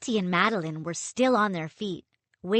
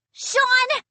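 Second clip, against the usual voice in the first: high, loud call across waves; a sister shouting her brother's name; 6 dB louder than usual. Acted. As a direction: call desperately to your brother. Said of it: "I tried to call to him".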